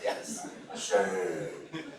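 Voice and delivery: chuckling self-mockery; laughing admission. Real, not acted. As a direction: concede it while cracking up. Lecturer admits it, laughing.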